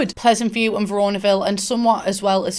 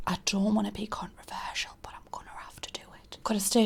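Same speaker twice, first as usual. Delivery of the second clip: whispering; 12 dB quieter than usual. Real, not acted. Confessing a controversial rating.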